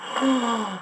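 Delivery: mock gasp of shock. Friend reacting to a sudden breakup, shocked gasp. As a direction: suck in a breath, aghast.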